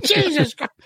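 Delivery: high, laughing disbelief; laughing mock shock. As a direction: gasp it out in giggling horror. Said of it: "You're like".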